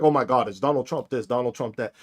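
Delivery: a sneering imitation that trails off; mocking whine. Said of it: "the only thing you're gonna be like".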